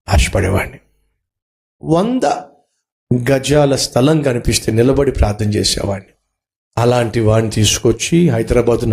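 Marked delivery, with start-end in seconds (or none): none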